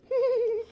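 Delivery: high giggle. Real, not acted. Laughing at the dog.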